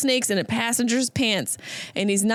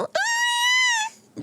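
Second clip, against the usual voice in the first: very high, squeaked exclamation; squeaky "oh yeah". Pretend. Comedian squeaks agreement about bringing the dog, very high. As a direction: squeak it out like a cartoon.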